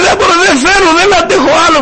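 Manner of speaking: a wife at the end of her rope, lightly voiced; desperate wife. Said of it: "κουρασμένη, απελπισμένη".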